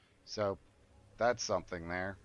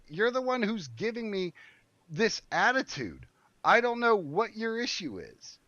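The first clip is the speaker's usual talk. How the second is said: high, raised voice protesting; indignant pushback; 9 semitones higher, 3 dB louder than usual. Retold or imitated. To the receptionist accusing him of attitude, indignant. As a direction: fire the blame straight back.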